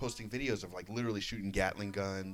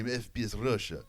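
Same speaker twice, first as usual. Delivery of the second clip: put-on Russian accent. Scene: retelling the YouTuber's catchphrase to a friend, mock Russian accent. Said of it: "He talks like this".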